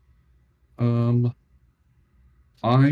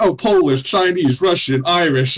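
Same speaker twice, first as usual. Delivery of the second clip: excited listing; 5 semitones higher, 7 dB louder than usual. Retold or imitated. Listing ethnicities, voice raised.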